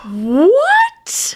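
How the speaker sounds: high, stunned "what"; shocked. Real, not acted. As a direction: blurt it out in disbelief. Reacting to shocking revelation.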